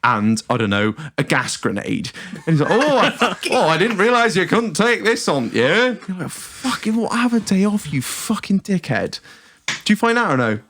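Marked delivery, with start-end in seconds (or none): 2.7-5.9 high-pitched Northern whinge; entitled angry bloke. Acted. Entitled passenger to security, in a high Northern whine. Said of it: "mildly entitled, angry, middle-aged man as Northern". 6.3-9.2 high-pitched mock contempt; exasperated retort. Fed-up traveller to entitled passenger, in mock-angry contempt.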